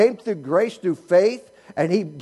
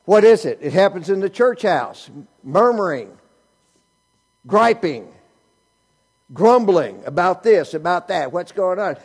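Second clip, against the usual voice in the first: ordinary talk, nothing marked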